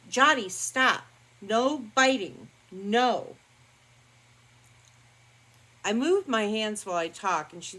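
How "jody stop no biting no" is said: louder, higher stern shout at the dog; real sharp reprimand; loud scolding. Real, not acted. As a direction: snap the dog out of biting. Scolding dog biting her.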